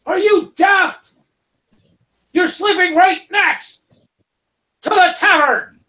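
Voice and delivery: high, slow, loud disbelief; exasperated high kobold yell; scolding guard shout. Acted. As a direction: scold them like fools. Guards scold sleepers by tavern.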